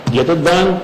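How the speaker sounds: ordinary talk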